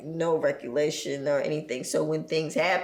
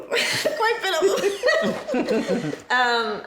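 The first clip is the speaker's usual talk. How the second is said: slow, laughing through the words; rueful laugh while speaking; 11 semitones higher, 5 dB louder, much slower than usual. Real, not acted. Laughing at painful memories.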